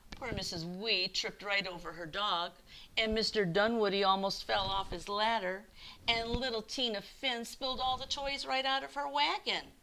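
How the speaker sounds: ordinary delivery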